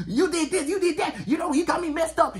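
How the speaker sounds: high-pitched ranting imitation; mock angry girl. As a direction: mimic her going off, way up high. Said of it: "the girl that gets mad".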